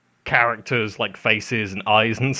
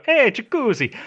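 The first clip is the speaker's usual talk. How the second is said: put-on Italian accent; 10 semitones higher than usual. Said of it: "if you say it in an Italian accent".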